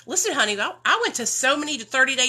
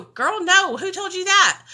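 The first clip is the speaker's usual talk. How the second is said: higher, louder shocked denial; mock surprised denial; 7 semitones higher, 5 dB louder than usual. Friend Ashley denying the rumor, surprised and loud. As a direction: brush the rumor off in disbelief. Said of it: "And she'll go".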